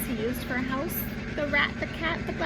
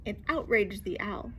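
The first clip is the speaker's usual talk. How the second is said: usual speaking voice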